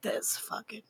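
voiceless whisper under breath; a secret curse he whispers to himself; whispered aside. Retold or imitated. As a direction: hiss it as if muttering to himself. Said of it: "he's like".

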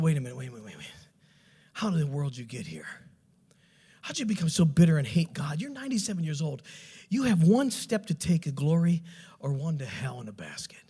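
low, hushed bedside appeal; quiet, grave questioning; earnest confrontation. Retold or imitated. Retells earnest talk at bedside.

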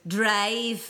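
mock-bad Irish accent on "Drive"; bad Irish accent imitation. Mocking Pryce's botched line, bad Irish accent. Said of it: "Jonathan Pryce goes".

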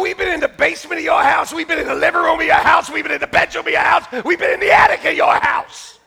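fast, loud mock trash talk; shouted comeback. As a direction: yell it right back at him, rapid fire. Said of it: "I go".

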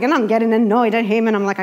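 ordinary talk

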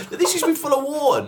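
high-pitched imagined guide; imagined museum guide. Acted. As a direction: put on a high explaining voice. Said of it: "Just like".

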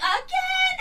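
high-pitched imitated friend asking; mock disbelief. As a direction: squeak it in disbelief.

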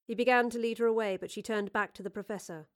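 ordinary delivery

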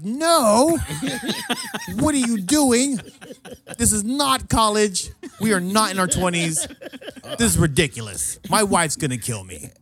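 high, loud, drawn-out comic scolding; mock outraged protest. Host scolding drunk buddy wrecking the house, mock outraged. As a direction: plead loudly in exaggerated exasperation.

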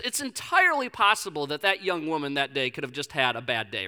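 ordinary talk, nothing marked

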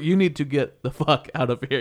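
normal talking voice

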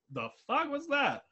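a dazed ape caught off guard; baffled King Kong. Retold or imitated. King Kong reacting to Godzilla's fire, baffled. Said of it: "King Kong's fucking blinking like".

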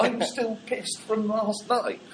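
hungover tourist. Pretend. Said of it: "drunk holidaymaker".

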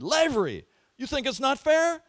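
high, loud mocking challenge; challenging professor. Professor challenging a protesting student, high and loud. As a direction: throw the question back at him.

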